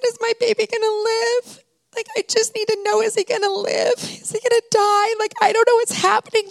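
high, teary pleading to the technician; tearful plea. Retold or imitated. Frightened mother begging the technician whether her son will live, teary. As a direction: beg through tears for an answer.

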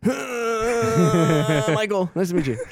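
high, nervous squeal into a greeting; mock-terrified, high-pitched hello; scared, starstruck. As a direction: act out a panicked fan meeting an idol. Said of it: "I was so scared".

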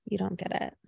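a mock-exasperated teen sigh of a line; dismissive brush-off. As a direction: wave mom off, annoyed and fast. Brushing off mom's question.

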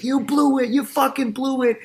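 high-pitched mock outburst, losing his cool; imitated anger. Retelling De Niro starting to lose his cool, imitated anger. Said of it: "that anger where he pulls off the shirt".

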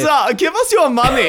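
high, hammed-up German bully; mock German accent. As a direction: demand it in a comic German accent. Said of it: "the crew was mostly German".

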